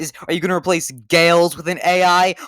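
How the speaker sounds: hammed-up angry interrogation, building in pitch; mock outrage. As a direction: rant at them in exaggerated fury.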